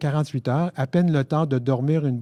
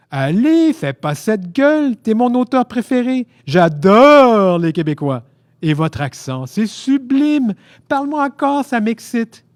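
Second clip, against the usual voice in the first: high, loud, drawn-out raptures; gushing admirer; 8 semitones higher, 7 dB louder, slower than usual. A narrator's reading. Frenchman flirting with his favourite author, gushing. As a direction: gush theatrically, stretching the key words.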